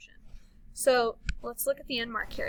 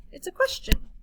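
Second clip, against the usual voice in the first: much higher; squeaky high explaining; 10 semitones higher than usual. Shown as a demonstration. Teacher to kids explaining a question, high. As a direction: pop the words up high.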